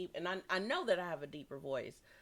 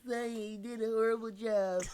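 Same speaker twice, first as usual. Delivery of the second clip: higher, louder mocking friend voice; mock teasing friends; 7 semitones higher, 7 dB louder, slower than usual. Imitating friends calling to mock her broadcast. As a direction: tease like a laughing friend. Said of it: "my friends are, like, calling me".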